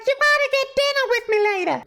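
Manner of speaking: very high, insistent falsetto showgirl; showgirl repeating herself. Retold or imitated. Showgirl repeats her invitation through the tank glass, piercingly high falsetto. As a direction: squeal it through thick glass. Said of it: "I can't hear you".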